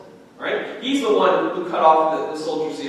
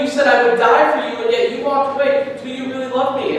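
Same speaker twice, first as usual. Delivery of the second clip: pleading building to rebuke; 6 semitones higher than usual. Retold or imitated. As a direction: press him harder and harder. Jesus confronting Peter, building.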